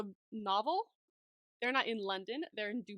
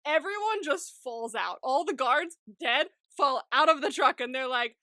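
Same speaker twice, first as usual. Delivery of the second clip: normal talking voice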